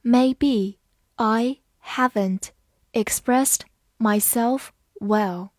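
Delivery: slow, careful read-aloud x0.5; each word spaced out, slowly. Shown as a demonstration. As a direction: read it slowly so learners can follow. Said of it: "好，慢慢来读".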